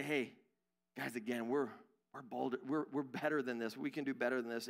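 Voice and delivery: low, soft, tactful appeal; gently reproving. Acted. Coworker stepping in on office gossip, gently reproving. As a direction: quietly talk the group down, halting.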